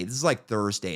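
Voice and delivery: normal talking voice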